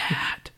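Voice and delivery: Nat said as if in Vietnamese, voiceless; gross-sounding pronunciation. Shown as a demonstration. Showing how gross the name sounds, voiceless Nat. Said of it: "that just sounds gross".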